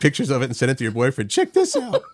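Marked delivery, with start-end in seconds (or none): 1.3-2.0 high, laughing mock squeal; excited show-off. Joking about texting a photo to a boyfriend, excited show-off. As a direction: squeal it much higher, half laughing.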